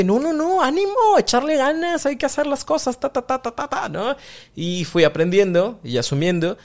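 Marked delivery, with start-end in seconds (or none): 0.0-4.2 much higher, bright chirpy dismissal; mock cheery brush-off. Parent waving off sadness with high mock cheer. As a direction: cheer them up falsely, high and quick.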